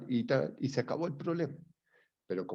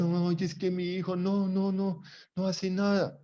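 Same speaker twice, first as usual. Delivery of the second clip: imitating a complaining mother, higher; a hint of a mother whining about her son; 7 semitones higher than usual. Lecturer imitating a mother who complains her son does nothing, higher mock whine. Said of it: "la mamita dice".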